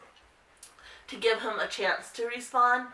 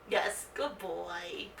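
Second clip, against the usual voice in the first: slow, much softer praise; 3 semitones lower, 7 dB quieter, much slower than usual. Real, not acted. Owner praising her nervous dog, slow soft praise. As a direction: draw the praise out gently.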